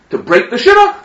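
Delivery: high-pitched mock indignation; indignant threat. Groom after hearing bride's excuse, high indignant threat. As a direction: pitch it way up, affronted.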